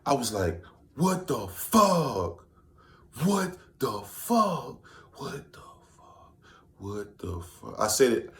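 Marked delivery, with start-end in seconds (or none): 1.0-4.9 very slow, high drawn-out what the fuck; stunned disbelief. Retold or imitated. Hearing of Kobe Bryant's death, drawn out in disbelief. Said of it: "I said W T F maybe for two minutes straight. different ways". 5.2-7.8 whispered what the fuck, fading; stunned whisper. Retold or imitated. Reading the news of Kobe's death, whispered in shock. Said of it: "I said W T F maybe for two minutes straight. different ways".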